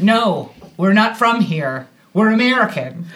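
firm, measured retold answer; contained, emphatic brush-off; restrained refusal. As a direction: state it plainly without screaming. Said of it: "But what we did do".

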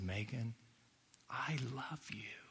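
soft, hushed, barely voiced; tender whisper. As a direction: whisper it gently like a forgiving friend. Tender forgiveness scene, retold.

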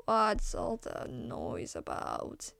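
slow, sleepy rasp; a grumpy boar woken up, rasping; a drowsy wild boar rasping the question. Acted. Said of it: "rasped the s-, creature".

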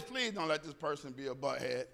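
hushed private plea under the breath; quiet worried inner voice; inner thought. Retold or imitated. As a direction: murmur it like a silent prayer. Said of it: "my thought is".

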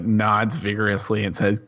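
normal talking voice

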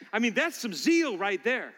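usual speaking voice

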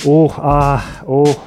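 short rhythmic "uh, ah" grunts; mock passionate moans. Courier and client wrestle over forms, mock-steamy moaning. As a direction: moan in time, hammed-up.

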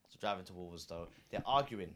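normal talking voice

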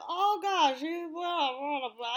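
high, loud mock grumbling; hammed-up semi-Southern grumble; grumbly Southern men. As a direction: mimic two grumbly Southern guys blathering. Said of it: "like, grumbly, semi-Southern men".

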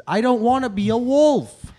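loud, whining refusal, half joking; whiny loud protest. Real, not acted. Pleading against becoming a wolf.

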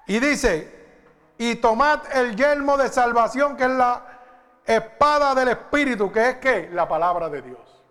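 loud, high, urgent sermon delivery; full-force preaching. Acted. Pastor warns the congregation about Satan's darts, loud preaching. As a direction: drive it home to the congregation.

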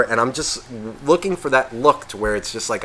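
ordinary delivery

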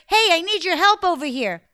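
high, loud call. Acted. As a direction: shout across the garden. Prince calling to her.

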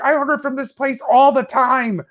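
pitched high and insistent; I'm a loyal customer, I'm outraged; high, insistent plea to the driver; indignant plea. Retold or imitated. Pleading with driver.

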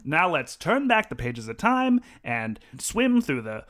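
slow, loud forties newsreel announcer; old newsreel. Pretend. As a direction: boom it out like an old-timey radio man. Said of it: "like, a sort of a nineteen forties newsreel?".

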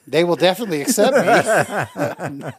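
joking through laughter; laughing. Real, not acted. Comedian teasing himself about joining the troupe, laughing. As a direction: let laughs break up the words.

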